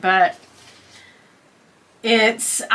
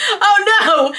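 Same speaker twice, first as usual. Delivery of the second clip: higher, lightly acted shock; mock dismay; 9 semitones higher than usual. Retelling the clerk's reaction to the screen, mock dismay. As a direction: imitate someone who has just seen too much.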